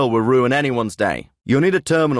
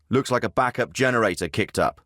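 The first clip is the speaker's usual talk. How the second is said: normal talking voice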